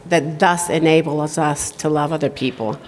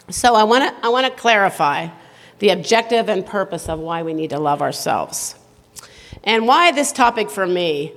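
normal talking voice

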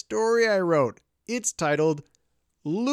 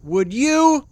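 raised-pitch yell, cut short; impatient yell; 4 semitones higher, 4 dB louder than usual. Acted. Impatient Clark yells from the tent door for his companion. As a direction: yell out through the doorway. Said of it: "poked his head through the door and yelled".

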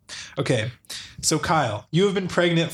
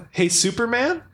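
cautious; 6 semitones higher than usual. Acted. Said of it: "You say cautiously".

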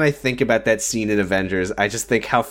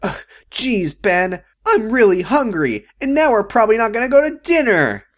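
mocking Betty; 9 semitones higher than usual. Imitating Betty whining to Ben about dinner, pitched up. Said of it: "She goes".

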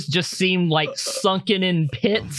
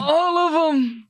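put-on high, slow old man insistence; 11 semitones higher, slower than usual. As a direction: insist like a cranky old clerk. GM voices old clerk.

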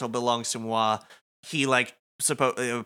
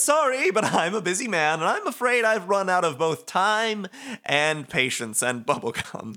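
much higher, sly villain voice with a laugh; smug Motoi voice; 7 semitones higher than usual. Acted. Motoi mocks Rindo for staring, high sly taunt. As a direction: tease him like a grinning schemer. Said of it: "Motoi says".

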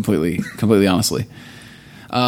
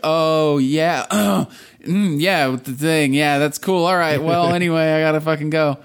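higher, awkward bluffing voice; mock flustered fake God; 5 semitones higher than usual. Fake God dodging Jesse's question, higher awkward bluff. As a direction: stall and bail out awkwardly.

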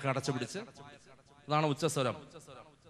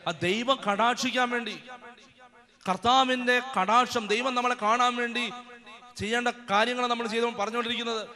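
fervent, raised sermon voice with call-and-response; preaching at full force; 4 semitones higher, 3 dB louder than usual. Acted. Preacher rousing the congregation to shout halleluja, fervent and loud.

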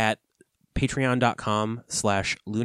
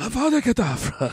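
Voldemort voice; 10 semitones higher, faster than usual. Acted. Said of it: "not even pronouncing that right".

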